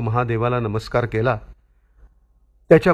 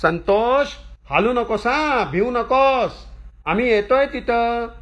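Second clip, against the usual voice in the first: much higher, long-drawn distant call; 10 semitones higher than usual. A narrator's reading. Father calls to son stuck up high, high drawn-out shout. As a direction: sustain a high holler to a child far above. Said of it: "वडील ओरडून म्हणाले".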